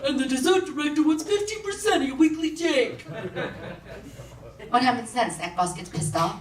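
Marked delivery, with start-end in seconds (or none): 0.1-3.0 high-pitched cartoon mafia henchman; hammed-up gangster voice, pitched way up; Jersey mobster. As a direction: imitate a Jersey wiseguy reporting to the boss. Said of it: "some Jersey greaseball guy with slicked back hair".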